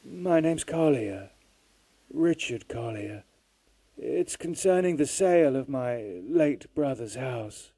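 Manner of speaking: distant phone voice. Acted. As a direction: sound like a faint long-distance call. Caller on long distance.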